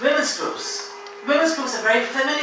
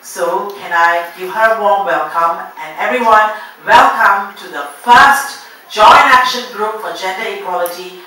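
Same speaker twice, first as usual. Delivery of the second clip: announcer; 11 dB louder than usual. Acted. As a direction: announce it big to the hall. Announcing the show host loudly.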